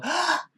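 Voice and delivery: high sharp excited gasp; mock gasp. Podcaster mimics the Rangers seeing Jason, a high excited gasp. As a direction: gasp like kids on Christmas. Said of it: "and go".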